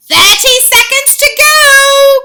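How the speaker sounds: high, loud, slow witch countdown; witch crying out. A narrator's reading. Grand High Witch counting down to the audience. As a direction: cry out the countdown gleefully. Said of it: "cried the Grand High Witch".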